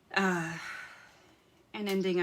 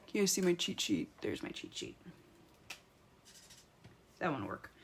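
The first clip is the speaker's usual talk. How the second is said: ordinary talk, nothing marked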